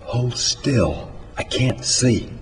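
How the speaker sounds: soft, hushed mild whisper. A narrator's reading. John working inside the sow, whispered. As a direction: keep it down, softly. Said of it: "John whispers".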